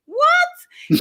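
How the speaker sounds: high, loud shocked "What?"; shocked mother. Retold or imitated. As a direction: imitate a scandalized mother's outburst. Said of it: "my mother would be like".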